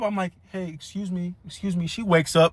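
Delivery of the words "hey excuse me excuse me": soft polite wake-up, much quieter. Retold or imitated. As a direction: gently rouse a sleeping stranger. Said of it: "So I politely wake his mom up".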